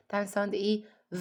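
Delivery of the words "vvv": "ف" held as a long buzzing v; sustained vibrating v sound for "ف"; V like a blender. Shown as a demonstration. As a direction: hum "ف" like a blender motor, voiced v. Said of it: "زي صوت الخلاط".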